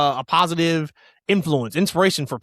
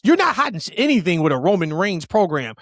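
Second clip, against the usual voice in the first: usual speaking voice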